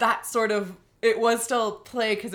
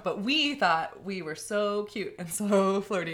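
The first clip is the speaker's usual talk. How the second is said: ordinary talk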